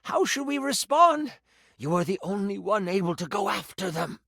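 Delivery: high-pitched, urgent appeal as the priest; pleading village priest. Acted. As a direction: press the hero for an answer.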